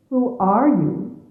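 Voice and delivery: ordinary talk, nothing marked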